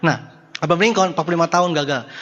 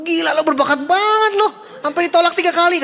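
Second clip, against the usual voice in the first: much higher, hammed-up sarcastic compliment; sarcastic mock praise; 11 semitones higher than usual. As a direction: gush ironically over a friend's failures. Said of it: "Udah pasti bilang, mana mungkin".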